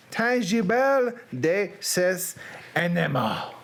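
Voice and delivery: put-on slow, labored Southern-accented French. Reader struggling through French for the producer, Southern accent. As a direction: sound out each French phrase slowly as a Southerner. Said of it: "Reading French in a Southern accent".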